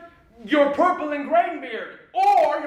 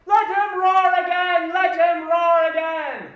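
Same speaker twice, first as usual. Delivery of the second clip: loud lion-like roaring boast; 6 semitones higher, 4 dB louder than usual. Acted. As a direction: roar it out to impress. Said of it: "I will roar".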